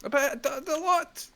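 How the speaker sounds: exasperated. Acted. Referee boss berating manager.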